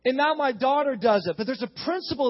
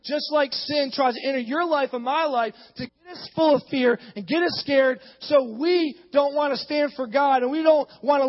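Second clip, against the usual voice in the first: building, driving preacher's cadence; fervent preaching; 5 semitones higher, 5 dB louder than usual. Acted. Preacher rallying church against fear, fervent preaching. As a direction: build to a loud declaration.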